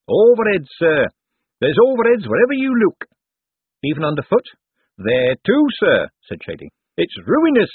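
a cheerful rogue grumbling about costs; wheedling old foreman. Acted. Narrator voices Shady the foreman.

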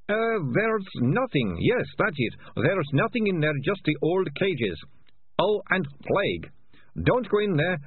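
high, evasive character voice; shifty ratcatcher babbling excuses. A narrator's reading. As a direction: improvise a lie, pitched well up.

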